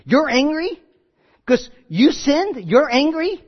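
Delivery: high, incredulous challenge; mock indignation. God's imagined retort to angry Cain, mock indignation. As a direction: throw it back as a disbelieving question.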